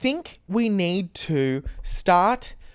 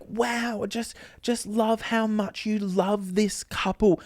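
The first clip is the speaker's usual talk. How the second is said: gushing guests; 4 semitones higher, 5 dB quieter, slower than usual. Retold or imitated. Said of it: "were like".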